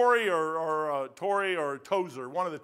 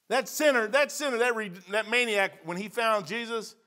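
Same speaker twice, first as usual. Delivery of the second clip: emphatic preaching; 5 semitones higher, 4 dB louder than usual. Acted.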